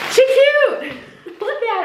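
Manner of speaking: high squeal with a laugh; delighted squeal. Real, not acted. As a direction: gush over it, laughing. Delighted at the sweater.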